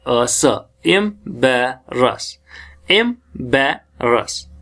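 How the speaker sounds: "एम्बेरस" built slowly from separate sounds; sounding out phonemes. Shown as a demonstration. Teacher building up embarrass, sounding it out piece by piece. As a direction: break "एम्बेरस" into em, be, ras with pauses. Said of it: "ये हमारी sound जो है".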